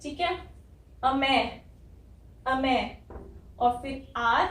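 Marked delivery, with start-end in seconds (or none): 1.0-2.8 'अमे' in isolation, stressed open 'me'; syllable drill. Shown as a demonstration. Teacher builds America up for learners, emphatic 'अमे'. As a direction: punch the second syllable of 'अमे', then stop. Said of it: "ई की sound आ रही है ए".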